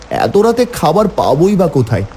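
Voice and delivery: ordinary delivery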